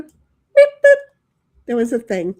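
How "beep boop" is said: high, loud electronic beep boop; beep boop sound effect. Pretend. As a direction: mimic the jingle's beeping tag. Jingle sound effect ending.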